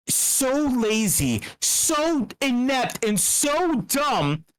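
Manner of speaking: each word slow and heavy; slow emphatic contempt. Real, not acted. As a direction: drag out each insult, slowly.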